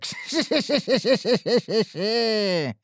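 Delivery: smug, drawn-out laugh; self-satisfied laugh. Acted. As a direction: laugh at your own greatness. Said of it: "довольно проговорил".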